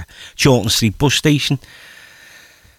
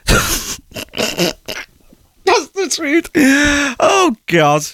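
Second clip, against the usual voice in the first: high, squeaky words through laughter; helpless laugh squeezing the voice high; real laughing; much slower than usual. Real, not acted. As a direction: crack up while talking. Cracks up at his own story.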